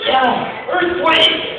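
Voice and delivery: high-pitched mock warning cry; false alarm. Redhead tricking the firing squad, high alarm cry. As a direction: sound a sudden alarm.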